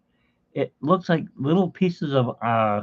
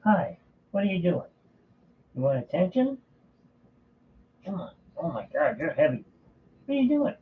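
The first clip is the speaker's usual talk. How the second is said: pet talk; 5 semitones higher, 5 dB quieter, faster than usual. Real, not acted. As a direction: coo at him like a sweet pet. Cooing to the cat on camera.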